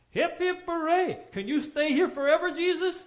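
high, loud hammed-up cheer; mock cheering crowd. Farmers hamming up a cheer for Jesus, high and loud. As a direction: belt it like a giddy crowd.

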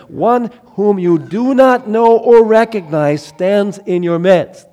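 loud, high, slow proclamation; ringing prophetic declaration; proclaiming. Acted. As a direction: cry it out to the crowd. Proclaiming John's words.

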